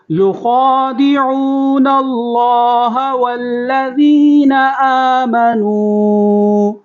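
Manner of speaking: slow, high melodic recitation of ختم اللہ علی قلوبہم; model recitation. Shown as a demonstration. Teacher recites so students can listen carefully, slow melodic chant. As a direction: chant it slowly for students to copy. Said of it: "اگلی آیت پڑھیں گے".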